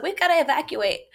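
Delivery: urgent news in an unworried, lifted voice; unpanicked neighbor. Retold or imitated. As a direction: imitate a neighbor calmly announcing an evacuation. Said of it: "this accent that made it not sound very panicky".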